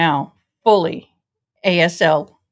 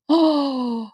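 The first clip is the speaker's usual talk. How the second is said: a sharp, high in-breath of delight; surprised gasp; 5 semitones higher, 3 dB quieter than usual. Real, not acted. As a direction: gasp as if a kind message caught you off guard. Reacting to a viewer's greeting.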